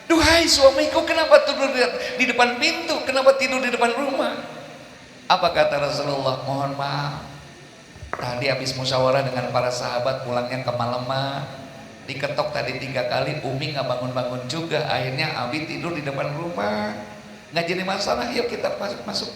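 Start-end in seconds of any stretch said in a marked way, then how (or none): 0.1-4.4 lightly voiced wife, higher and surprised; a hint of a startled young wife calling out. Startled wife finding husband asleep at door, higher and surprised. 6.4-19.3 softer, tender and unhurried; gentle reply. Retold or imitated. Prophet gently reassures his wife at the door, soft and tender.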